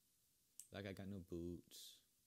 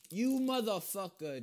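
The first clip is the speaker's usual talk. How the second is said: furious scream, slow and higher; swearing tirade; 12 semitones higher, 14 dB louder, slower than usual. Real, not acted. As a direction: scream the insult at him. Raging at another player.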